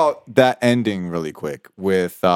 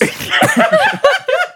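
high, squeaky burst of laughter; real laugh; 3 dB louder than usual. Real, not acted. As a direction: break into giggles. Laughing at own joke.

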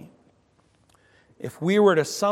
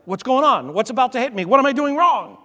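startled; 8 semitones higher, 6 dB louder than usual. Retold or imitated. Startled driver's thoughts.

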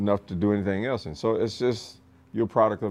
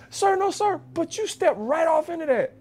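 recruit answering. Retold or imitated. Recruit and instructor exchange.

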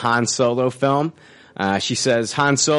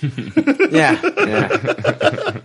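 laughing. Real, not acted. Laughing high agreement.

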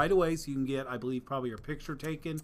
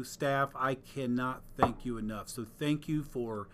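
ordinary talk, nothing marked

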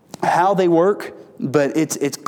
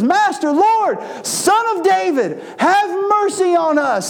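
much higher, slow desperate plea; crying out for mercy; 13 semitones higher, slower than usual. Acted. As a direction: call out to Jesus as a blind man begging. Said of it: "they were crying out to the Lord".

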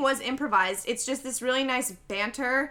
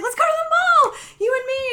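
much higher, louder excited squeal; 9 dB louder than usual. Retold or imitated. Ashley begging Madeline to go to the mall, squealing. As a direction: squeal it like a thrilled kid.

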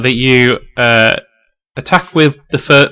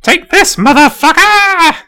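very high, strained attack yell; battle cry; 5 dB louder, slower than usual. Acted. Eddie swinging a great axe at the ogre, high battle cry. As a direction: scream it, stretching the last word out. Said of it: "and he goes".

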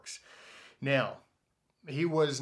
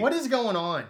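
loud, higher exasperated outburst; 8 semitones higher, 7 dB louder than usual. Real, not acted. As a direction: throw up your hands in exasperation. Exasperated at triple masking.

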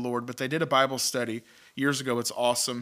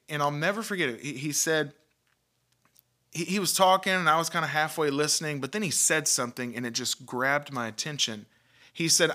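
usual speaking voice

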